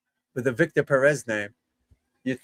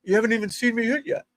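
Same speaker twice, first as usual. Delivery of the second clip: much higher mock protest from a golf student, imitating; indignant student; 9 semitones higher, faster than usual. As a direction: play the offended student who hasn't hit a ball yet. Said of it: "And he's like".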